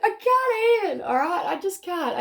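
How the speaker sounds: high and loud, losing it with giddy laughter; cracking up, can barely get the words out; real overexcited squeal of delight. Real, not acted. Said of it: "I've gotta pull myself back".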